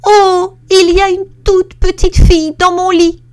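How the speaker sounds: put-on very high, very loud squealing baby bear; tiny voice cry. Little bear finds the girl in his bed, high squealing cry. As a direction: shriek it like a tiny startled cub. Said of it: "crie de toute sa petite voix".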